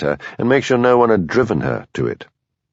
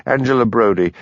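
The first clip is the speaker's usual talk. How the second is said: ordinary delivery, nothing marked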